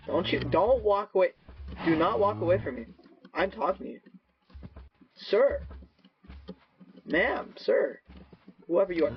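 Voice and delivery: hammed-up demand that they not walk away; mock offended movie line; movie quote. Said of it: "those quotes from the movie".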